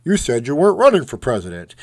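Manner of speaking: put-on voice of an indignant accuser; mock accuser. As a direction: throw it back as a goofy gotcha. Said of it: "people are just gonna dig up what he said today and go".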